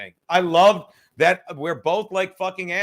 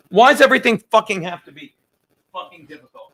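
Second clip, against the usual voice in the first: exasperated outburst; 9 dB louder, faster than usual. Real, not acted. Frustrated with the cigar lighting.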